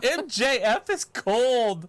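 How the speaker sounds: high, loud, drawn-out reaction; slow, high exclamation stretching the last word; impressed exclamation. Real, not acted. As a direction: react big to a brutal burn. Exclaiming at a harsh put-down.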